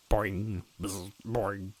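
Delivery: faint, very soft broken buzzing; pathetic robot buzz. Acted. Broken robot sputtering, faint pathetic buzz. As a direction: sputter like dying static. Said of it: "it buzzed pathetically".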